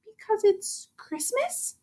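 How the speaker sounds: slow, soft and hushed; soft sincere answer. Acted. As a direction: say it gently, as if it explains everything. Elf's heartfelt simple answer.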